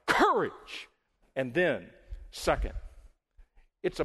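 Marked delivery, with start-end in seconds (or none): 0.1-0.9 high, ringing one-word cry; sharply raised preacher's shout. Acted. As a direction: cry COURAGE out over a storm. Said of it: "Jesus cried".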